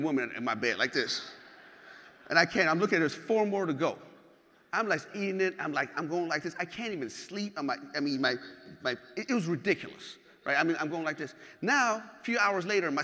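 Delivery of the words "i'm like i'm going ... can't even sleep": ordinary delivery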